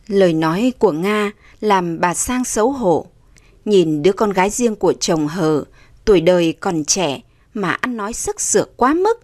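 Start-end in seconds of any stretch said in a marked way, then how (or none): none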